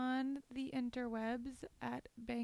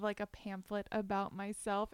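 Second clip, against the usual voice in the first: ordinary talk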